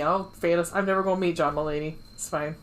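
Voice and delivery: ordinary delivery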